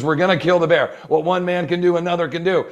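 rally him against the bear; determined movie shout. Retold or imitated. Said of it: "And he goes".

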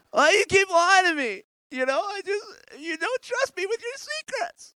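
high, wounded mock falsetto; Delilah. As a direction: plead hurt in an exaggerated woman's pitch. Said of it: "This is Delilah's voice".

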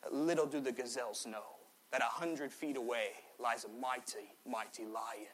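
a mock soothing Australian documentary voice; bad Australian accent. Australian wildlife narrator whispering about the lion, mock Australian accent. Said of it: "worst Australian voice you've ever heard".

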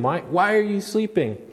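high, exasperated reproach; scolding. Retold or imitated. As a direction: demand it like a scolding. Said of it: "they were scolding him".

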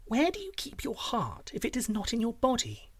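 soft high princess voice; coaxing princess. Acted. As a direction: ask sweetly, high and wheedling.

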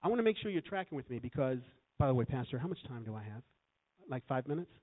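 ordinary talk, nothing marked

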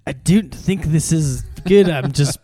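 put-on Irish accent as Shrek would do it; Shrek-style accent. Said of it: "I'm just going off of Shrek".

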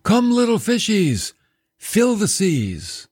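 high, drawn-out summoning call; playful Creator's command. Acted. Preacher voicing the Creator calling the fish, high playful summons. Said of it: "He's the one that said".

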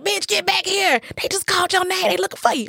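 imitating a friend's high call to hurry back. Friend calling her back urgently.

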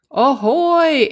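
high, loud answering hail; calling back. A narrator's reading. Allie the alligator answers Igor's hail, calling back.

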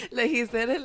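ordinary delivery

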